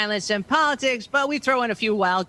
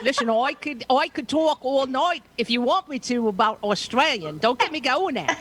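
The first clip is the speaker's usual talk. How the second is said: higher-pitched mock Aussie accent; badly faked Australian accent; 4 semitones higher than usual. Host teasing her Australian co-host, badly faked Aussie accent. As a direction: put on an unconvincing Australian accent. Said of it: "that does not sound like Australian".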